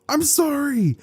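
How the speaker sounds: high panicked cry; panicked yell. Acted. Panicked boy fleeing the crowd, high yelping apology. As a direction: yelp it out while running away. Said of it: "he would yell out".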